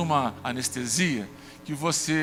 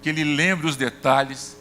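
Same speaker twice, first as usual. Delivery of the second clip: fervent prayer, a bit louder; retold prayer; 3 dB louder than usual. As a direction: pray it out with conviction for the doctor. Pastor retells prayer before surgery.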